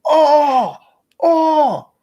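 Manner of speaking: high strained yelp of pain; pained groan after a shot. Retold or imitated. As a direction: wince like a harsh shot burned. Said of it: "and then they go".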